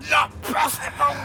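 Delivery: put-on high, shrieking old man's disbelief; terrified cry. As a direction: cry out in sheer terror. Old man attacked by invisible thief.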